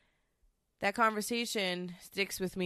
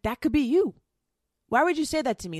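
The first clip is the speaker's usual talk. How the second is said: high, loud, furious outburst at her ex; angry retort; 8 semitones higher, 6 dB louder, faster than usual. Retold or imitated. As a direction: fling the words back at him in anger. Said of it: "I was so angry at him because I was like".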